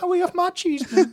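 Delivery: put-on loud, squeaky-high mouse with a Geordie accent. Hosts riffing on a squeak, high Geordie mouse voice. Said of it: "Geordie Mouse".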